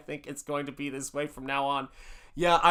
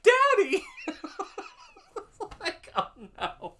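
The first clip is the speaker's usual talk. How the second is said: high shocked squeal of Daddy! breaking into laughter; a daughter's mock-scandalised shriek, much higher and louder; imitating shocked daughter; 5 dB louder than usual. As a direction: mimic the daughter's horrified cry, laughing. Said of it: "She's like".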